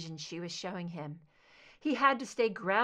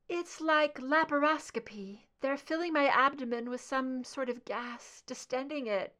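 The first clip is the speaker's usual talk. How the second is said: higher, hurting voice; pained, desperate; 6 semitones higher, 3 dB louder than usual. A narrator's reading. Scully telling Mulder they cut her, in pain. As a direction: tell him while it hurts.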